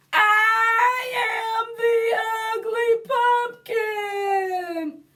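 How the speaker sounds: loud, high, sung-out cry; shouted. Acted. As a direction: shout it up at the sky. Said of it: "I shouted to the sky".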